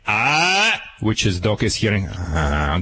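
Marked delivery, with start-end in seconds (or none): 0.1-0.8 short sharp "Ah!" shouted at the dog; sharp startling yell. Shown as a demonstration. Trainer showing how to startle a nipping puppy, sharp yell. 2.1-2.8 low growl. Shown as a demonstration. Trainer imitating what the dog hears, low growl.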